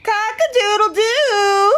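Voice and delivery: high, loud rooster crow. A narrator's reading. Rooster crowing at dawn, loud and high.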